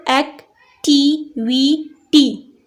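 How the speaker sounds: एक्टिविटी very slowly as एक, टि, वि, टी; syllable by syllable. Shown as a demonstration. Teacher giving an example of a multisyllabic word, split by syllable. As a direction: break "एक्टिविटी" into four separate beats. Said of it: "टी भी syllable है".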